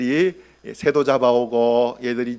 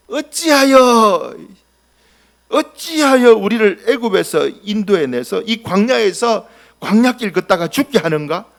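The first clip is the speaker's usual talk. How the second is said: grumbling complaint; 5 semitones higher, 4 dB louder than usual. Acted. Crowd whining at Moses and God, aggrieved. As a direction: moan it out like a resentful crowd.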